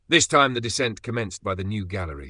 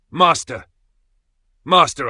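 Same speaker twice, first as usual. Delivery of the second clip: higher, much louder call of Master; shouting; 7 semitones higher, 11 dB louder than usual. A narrator's reading. Axel shouting at Hans about his uncle. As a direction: shout it out in desperation. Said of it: "I shouted".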